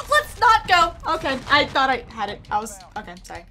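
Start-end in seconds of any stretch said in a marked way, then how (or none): 0.1-0.9 high, loud excited yell; raised voice. Real, not acted. Player yelling at a failed play, raised voice. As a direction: yell it out, pitched way up. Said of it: "Don't mean to raise my voice".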